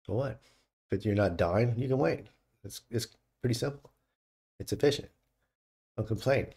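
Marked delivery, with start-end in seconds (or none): none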